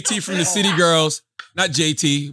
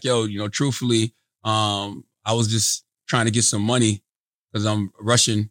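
low, subdued earnest confession; mock-dramatic hushed sincerity; sad and real; 10 semitones lower than usual. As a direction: play it straight as a worried dad, hesitant and low. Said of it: "you wanna go, like, sad with it?".

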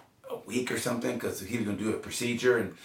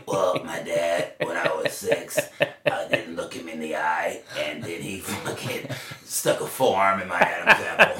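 put-on kid answering; 5 semitones lower, slower than usual. Kid's answer in story.

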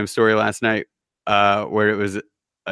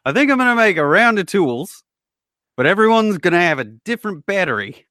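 hammed-up Aussie accent, much higher and louder; terrible Australian accent up high; a tool maker announcing a scheme in a mock Australian accent; bad Australian accent; 8 semitones higher, 3 dB louder than usual. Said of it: "That was a terrible Australian accent".

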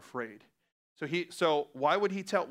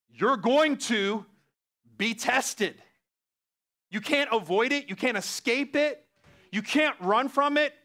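building preaching; 6 semitones higher, 4 dB louder, slower than usual. Real, not acted.

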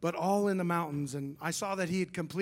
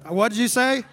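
loud, high what did you say; stunned loud question; loud disbelief; 5 semitones higher, 11 dB louder than usual. Retold or imitated. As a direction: blurt it out in loud astonishment. Pretend amazed question to friend.

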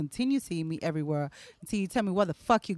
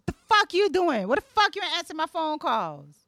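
put-on angry girlfriend; 7 semitones higher, 4 dB louder than usual. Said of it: "So then he'll know".

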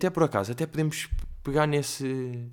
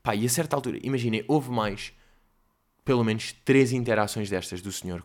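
normal talking voice

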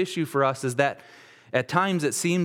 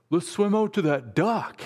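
put-on higher, keen teen voice; eager students; 7 semitones higher than usual. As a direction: sound like excited kids calling out. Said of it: "a bunch of the students said".

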